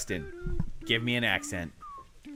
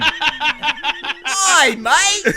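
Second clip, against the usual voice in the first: big, high hammed-up Aussie voice; Australian accent; 11 semitones higher, 7 dB louder than usual. A friend requests Australian, quiz host obliges in hammed-up Aussie accent. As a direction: play the cheery Aussie mate.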